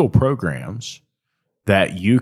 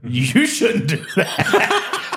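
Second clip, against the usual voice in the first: laughing; 9 semitones higher, much slower than usual. Real, not acted. Laughing at the southern twang idea.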